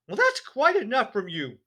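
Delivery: loud, high indignant snap; snapping wife. A narrator's reading. Embarrassed mother snapping at Matilda, loud and high. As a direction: cut the child off sharply. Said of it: "Missus Wormwood said, turning pink".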